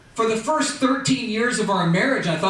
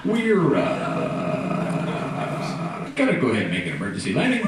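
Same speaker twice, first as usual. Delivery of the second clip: lower, laid-back airline captain; deadpan pilot over the intercom; pilot announcement voice; 3 semitones lower than usual. Acted. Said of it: "Why do pilots talk like that?".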